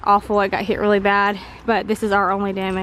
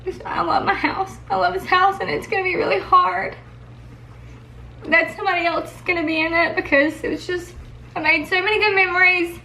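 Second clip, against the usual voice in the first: high, teary, voice wavering; trying not to cry; emotional, near tears; 4 semitones higher than usual. Real, not acted. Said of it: "Sorry I'm emotional".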